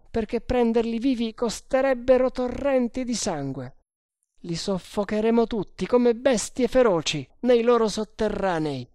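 high-pitched, emphatic threat; ruthless sergeant. Acted. Sergeant explaining why none will be spared, ruthless.

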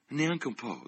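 high, mildly tremulous denial; trembling lips. A narrator's reading. Lucy refuses the old man's concern, lips trembling. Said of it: "disse Lucy con labbra tremanti".

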